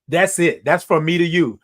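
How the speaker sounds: ordinary talk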